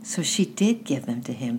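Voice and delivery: plain reading voice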